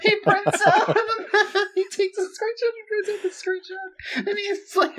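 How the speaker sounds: high, laughing through the words; cracking up while talking. Real, not acted. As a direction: squeal it out mid-laugh. Laughing hard while retelling.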